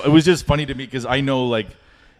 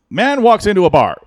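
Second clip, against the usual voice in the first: high, loud announcer-style opener; radio DJ joke delivery; 11 semitones higher, 8 dB louder than usual. Retold or imitated. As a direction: belt it out like a radio DJ. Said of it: "radio D J style street jokes".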